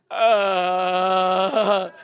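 long, drawn-out "uhhh"; imitated clueless groan, held on; dumbfounded drone. Leader mimicking a confused student, long blank groan. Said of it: "you were just like".

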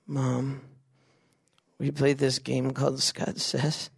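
soft, slow, halting confession; mock crestfallen. Kid breaking bad news to mom, sheepish and halting. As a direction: break it to her quietly, with long pauses. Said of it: "and you're like".

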